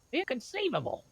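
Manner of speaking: movie villain's indignant cry, high-pitched; Vizzini. Retold or imitated. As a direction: yelp it in disbelief. Princess Bride quote voiced.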